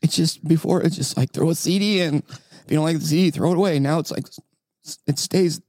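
high, cartoonish whimpering cry; hammed-up crying, a caricature of a sob; fake crying. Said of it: "You're so bad at fake crying".

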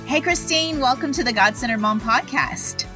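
usual speaking voice

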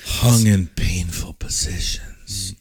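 slow sultry whisper. Pretend. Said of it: "Do it in your sexy [name] voice".

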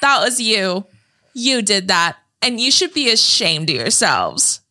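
high-pitched, drawn-out mock address; imitated movie girl. Quoting a movie girl addressing the room, high mock address. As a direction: call out each word high, rising. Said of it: "the way she's like".

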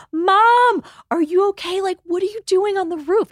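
put-on high, loud panicked little-girl call; alarmed child. Little girl calling up to mom on the roof, high and alarmed. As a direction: cry up in alarm like a scared kid.

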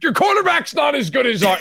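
high mock shout of a rival fan; mock shouted taunt. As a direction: jeer like a smug opposing fan.

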